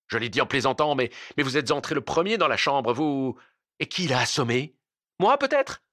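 heated self-defence, peaking high and loud; indignant accusation. A narrator's reading. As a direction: throw the blame back, outraged at the end. Accomplices quarrel, blaming each other. (accusation indignée qui monte)

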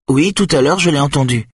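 put-on high, eager small boy; a child insisting he heard it; insistent young boy. A narrator's reading. Son insisting to his mother, high childlike voice.

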